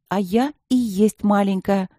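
ordinary delivery, nothing marked

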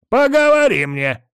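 sharp high growling snap. A narrator's reading. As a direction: bark it back at the grandson. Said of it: "рыкнул в ответ дед".

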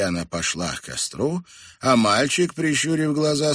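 ordinary delivery

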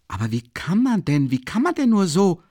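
verblüfft und höher, kopfschüttelnd fragen; ungläubiges Staunen, hoch und lauter. A narrator's reading. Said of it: "sagte der verblüffte Rasumichin kopfschüttelnd". (verblüfft, kopfschüttelnd)

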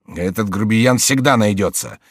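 usual speaking voice

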